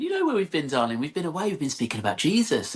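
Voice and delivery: high, gentle sing-song mother; doting mum voice. Retold or imitated. Mother answering her sassy daughter, high sing-song with a laugh. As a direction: reassure her like a sweet mum. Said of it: "And I went".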